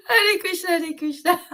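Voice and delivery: overwhelmed ecstatic sob-laugh; crying laugh. Real, not acted. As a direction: break into laughing tears. Laughing through chant.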